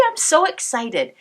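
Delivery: usual speaking voice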